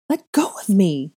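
loud, high demand to be released; struggling demand. A narrator's reading. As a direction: cry out while trying to break free. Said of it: "I tried".

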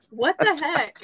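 high, laughing what the heck; laughing in mock disbelief; mock shock. Recalling comparing arms, mock shocked.

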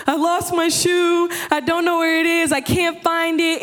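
hammed-up tearful complaint; about to cry. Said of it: "I'm about to cry".

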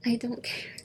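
whispered, fed-up; whispered annoyance. Retold or imitated. To a rude customer, whispered fed-up. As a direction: breathe it out under your breath.

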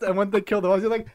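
ordinary talk, nothing marked

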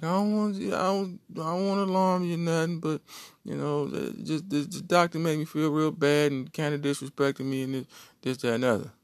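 put-on high, hesitant girlfriend voice; voicing girlfriend. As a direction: tell it shyly, up high like her.

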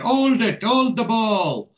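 high, loud, drawn-out touchline shout; shouted advice. Retold or imitated. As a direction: imitate yelling instructions at young footballers. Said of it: "Sandro's shouting advice".

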